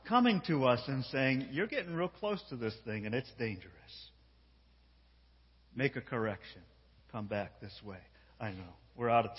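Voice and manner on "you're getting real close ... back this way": soft, low divine counsel; gentle warning. Acted. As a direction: quietly warn someone you care for. Voicing God's gentle warning.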